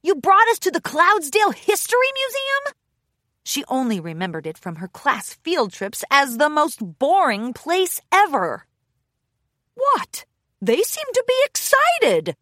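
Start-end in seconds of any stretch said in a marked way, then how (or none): 0.0-2.6 high disbelieving complaint, stress on us; exasperated. Acted. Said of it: "Rainbow Dash groaned". 9.7-12.4 very high, cheerful protest; surprised. Acted. Bo defends his choice.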